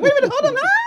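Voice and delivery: high, loud squeal breaking into a scream; excited scream. Retold or imitated. Caller retelling the long-awaited phone call, excited screaming. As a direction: shriek it out, very high, with a laugh in it. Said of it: "I just started screaming".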